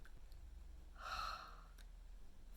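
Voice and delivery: a long breathy sigh out; deflated sigh; heavy sigh. Real, not acted. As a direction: let the air go in defeat. Sigh after failing.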